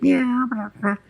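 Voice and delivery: very high mock meow; cat meow. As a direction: squeak a cat's meow. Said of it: "He'd be like".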